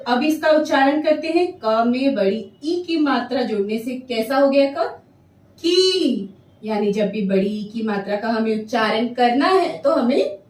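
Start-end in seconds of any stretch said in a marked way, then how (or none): 5.6-6.3 की with a stretched long ii vowel; drawn-out long ii. Shown as a demonstration. Teacher modelling की with a long stretched ii. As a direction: pull the vowel of की long for children. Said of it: "खींच के करना होता है उच्चारण".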